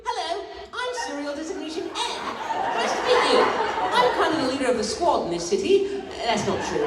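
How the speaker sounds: high-pitched, hammed-up British accent; bad British accent. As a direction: do a squeaky, not very good British audition voice. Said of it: "auditioned for Murder Drones with a British accent".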